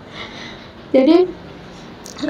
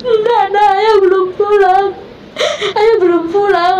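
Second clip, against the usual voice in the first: high, choked-up, almost crying; tearful denial; 5 semitones higher than usual. Retold or imitated. Mother telling her son father is not home, voice breaking. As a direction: retell your own words through tears.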